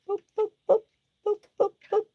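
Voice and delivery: high sing-song boop noises; playful high boops to coax a chicken; cutesy boops. Real, not acted.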